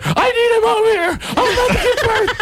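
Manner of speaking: very high, wailing mock plea; frantic wife. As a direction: imitate a panicked pregnant wife pleading. Wife pleading with fake policeman.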